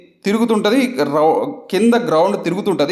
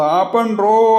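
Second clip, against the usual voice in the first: a raised, higher mock yell from a favourite film; quoted movie shout; 6 semitones higher, 4 dB louder, much slower than usual. Vlogger quoting his favourite film line to viewers, mock shout. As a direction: call out the film's line like a hammed-up cry to stop. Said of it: "నువ్వు నాకు నచ్చిన సినిమాలో".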